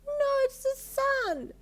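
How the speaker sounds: put-on high, loud, slow child insisting; emphatic child. A narrator's reading. Daughter contradicting dad, emphatic child voice. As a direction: stamp it out like a stubborn toddler. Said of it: "she replied emphatically".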